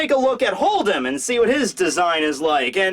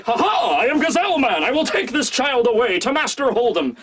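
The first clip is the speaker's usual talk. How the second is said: higher, heroic announcing voice; gallant Gazelle Man; 6 semitones higher than usual. Retold or imitated. Gazelle Man carrying Tama off, higher and heroic. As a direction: proclaim it like a dashing hero. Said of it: "just like".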